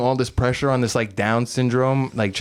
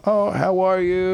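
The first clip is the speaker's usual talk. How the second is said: mock cheerful waitress; 7 semitones higher, slower than usual. A friendly greeting that never happened.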